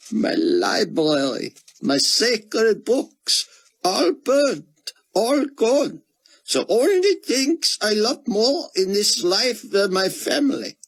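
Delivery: a broken scholar clinging to scripture; grieving Israeli scholar. A narrator's reading. Grieving scholar tells Buck his faith, high and squeaked.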